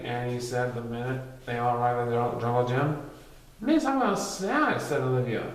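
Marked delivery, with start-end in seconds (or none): 3.6-4.8 higher, slow little voice; a kid sadly noticing; child voice. Acted. Olivia telling friends about the teacher, higher and slow.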